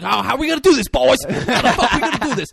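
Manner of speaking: high, loud taunting shout; acted screaming. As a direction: imitate a raging man challenging everyone around. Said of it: "he's just fucking screaming".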